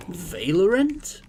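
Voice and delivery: "Valorant" as VAY-ler-unt, slow and rising; long first vowel. Shown as a demonstration. Trying a silly option for "Valorant", slow VAY-ler-unt question. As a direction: stretch "Valorant" with "vale" at the start, pitch climbing. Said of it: "How is that pronounced?".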